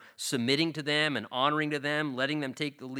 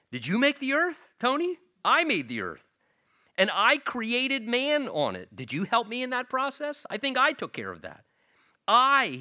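high, teasing question to a congregant; mock-incredulous God; 10 semitones higher, 4 dB louder than usual. God asking Tony if he helped make the earth, higher and teasing.

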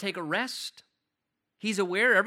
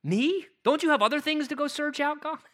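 higher and louder, amazed "Me?"; incredulous me; 8 semitones higher, 5 dB louder than usual. Acted. Pastor voices David amazed God searches him, incredulous and raised. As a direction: ask it as if you can't believe God would bother. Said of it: "I perhaps would put the emphasis on me".

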